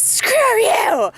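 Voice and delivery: sharp, high, loud scream; screaming at the ice. Retold or imitated. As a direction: spit it at the ice. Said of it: "left screaming at the ice".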